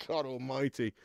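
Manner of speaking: ordinary delivery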